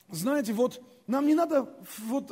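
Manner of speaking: ordinary delivery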